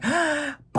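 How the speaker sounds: high, hammed-up gasp; dramatic gasp. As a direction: gasp in mock heartbreak. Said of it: "Maria's like".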